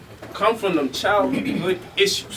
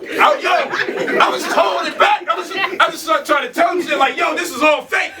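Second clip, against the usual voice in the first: much higher and louder, shouting the story out; excited shouting; 10 semitones higher, 4 dB louder than usual. Real, not acted. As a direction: shout it out, worked up and laughing at himself. Excited retelling of the beating.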